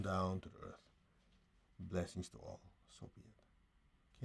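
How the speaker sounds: hushed closing blessing; guided meditation. Real, not acted. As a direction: let each phrase sink away quietly.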